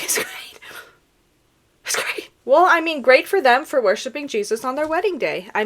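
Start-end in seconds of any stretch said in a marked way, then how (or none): 0.0-2.3 whispered through mock agony; strained whisper. Ticklish client enduring a manicure, strained whisper. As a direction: squeeze out a tiny whispered reassurance.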